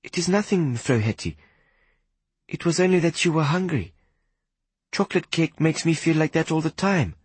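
put-on a small boy trying to comfort her; young boy voice.

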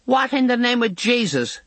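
a mother bursting through the door screaming. A narrator's reading. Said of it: "comes screaming through the door".

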